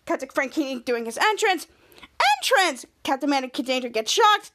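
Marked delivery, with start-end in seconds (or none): none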